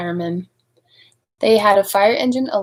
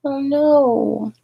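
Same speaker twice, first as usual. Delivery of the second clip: dismayed oh no; 5 semitones higher than usual. Real, not acted. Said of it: "reader reacts to chained mother".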